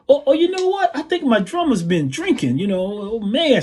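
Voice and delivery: high, sing-song mock complaint. Singer complaining onstage about his drummer, imitated high scolding. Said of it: "So he stops again".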